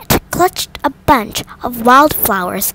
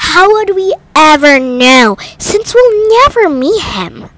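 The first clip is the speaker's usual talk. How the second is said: a lightly voiced sulky daughter, high and loud; a bored daughter complaining from the sofa; grumbling girl; 6 semitones higher, 5 dB louder than usual. A narrator's reading.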